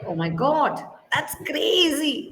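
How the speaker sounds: slow, rising, laughing that's crazy; amazed surprise. Shown as a demonstration. Teacher models being amazed by surprising news. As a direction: let the surprise spill into a laugh. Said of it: "And then".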